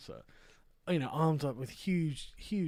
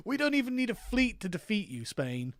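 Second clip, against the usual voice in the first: higher, gloating jeer; mock triumphant taunt; 6 semitones higher, 4 dB louder than usual. British gloating over Spain in a mock taunt. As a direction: crow it at Spain like you've won.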